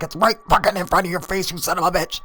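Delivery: very high, exasperated imitation of shouting; mock furious yell. Friend yelling back about the light, mock furious. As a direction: holler back high, cursing him out. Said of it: "he's yelling at his friend".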